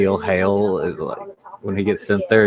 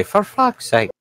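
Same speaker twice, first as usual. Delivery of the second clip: ghostly voice; 9 semitones higher, slower than usual. Acted. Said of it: "hear a ghostly voice say".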